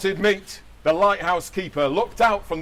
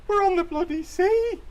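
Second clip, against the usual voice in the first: indignant Linda; 14 semitones higher than usual. Acted.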